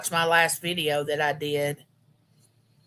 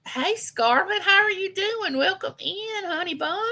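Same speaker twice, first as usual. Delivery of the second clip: much higher, sing-song hello to a viewer; sweet greeting; 12 semitones higher than usual. Real, not acted. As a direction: coo a welcome to a friend who just joined. Greeting a viewer joining the live.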